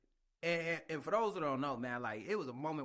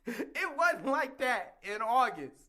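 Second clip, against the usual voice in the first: laughing; 9 semitones higher, 7 dB louder than usual. Real, not acted. Laughing while recounting past struggle.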